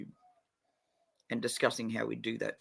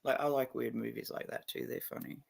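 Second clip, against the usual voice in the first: normal talking voice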